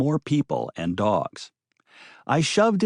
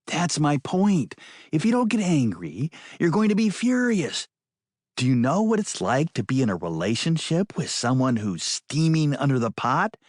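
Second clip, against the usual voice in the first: higher, insistent woman's voice; 5 semitones higher than usual. Acted. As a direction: press the point hard.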